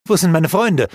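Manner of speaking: worried cry to his mistress for his friends; urgent demand. A narrator's reading. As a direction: press her for an answer.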